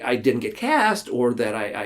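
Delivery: ordinary talk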